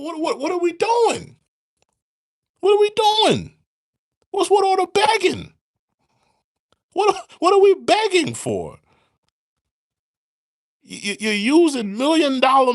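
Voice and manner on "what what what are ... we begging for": much higher, loud yelling; incredulous shout. Real, not acted. Ranting at the president over the begging, shouting. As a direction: yell it out in disbelief.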